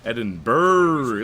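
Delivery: Edinburgh with the last syllable stretched into a long 'brrr'; drawn-out brr. Pretend. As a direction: stretch the end of Edinburgh like a shiver. Puns the ending into a shiver.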